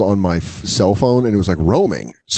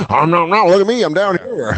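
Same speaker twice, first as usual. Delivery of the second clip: hammy comic voice; 7 semitones higher than usual. Pretend. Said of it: "be like".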